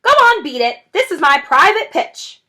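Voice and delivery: high and loud, chasing them off; shrieking rat. Acted. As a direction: shriek to defend his spot. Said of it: "shrieked Rat".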